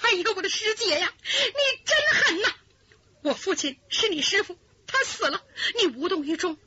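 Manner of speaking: high, crying shout of reproach; tearful accusation. Acted. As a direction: sob and rail at her coldness. Daughter accuses heartless sister.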